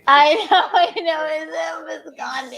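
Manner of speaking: high laugh-shout. Real, not acted. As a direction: crack up while talking. Laughing at the prank caller.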